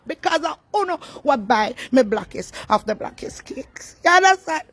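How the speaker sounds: passionate, loud and high thanks; excited. Real, not acted. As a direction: pour out the gratitude loudly.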